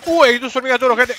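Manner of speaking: high, loud alarmed cry; startled at two enemies; alarmed surprise. Real, not acted. Sees two bull ants. (sorpresa alarmada, alta y fuerte)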